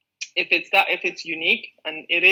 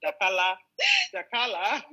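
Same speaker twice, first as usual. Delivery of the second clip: playful Yoruba accent, first one jumps up high; repeating Dakala in a Yoruba accent, half laughing; 7 semitones higher than usual. Shown as a demonstration. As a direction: say Dakala in a teasing Yoruba lilt. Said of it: "my Yoruba accent".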